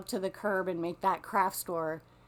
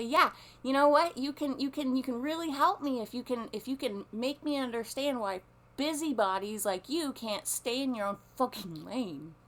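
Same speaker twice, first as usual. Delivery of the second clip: higher, louder sarcastic rant; imagined angry comeback; 6 semitones higher, 5 dB louder than usual. Retold or imitated. What she wanted to yell at the busybody, angry. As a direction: let it rip at the nosy lady. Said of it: "'Cause I wanted to say".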